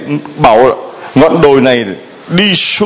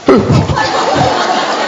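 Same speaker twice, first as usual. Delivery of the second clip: high laugh. Real, not acted. Laugh burst mid-story.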